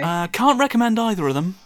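exasperated reviewer declaring a flop; mock dismay. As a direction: blurt it out like a comic verdict. Punchline after buying bad games.

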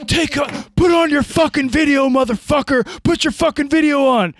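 high, loud yelling at the phone; re-enacting screaming at a troll on the phone; retold screaming. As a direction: scream it like a maniac. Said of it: "I am screaming at my phone".

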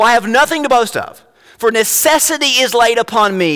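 high, emphatic preacher's reading; forceful scripture reading. Acted. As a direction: read scripture out with conviction. Reads scripture aloud with force.

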